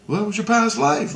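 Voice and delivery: high imitation of Christ. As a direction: voice him high and matter-of-fact. Christ voiced in dream story.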